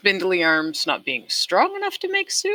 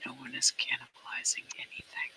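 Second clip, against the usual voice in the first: whispering; 8 dB quieter than usual. Acted. Hushing talk of cannibalism.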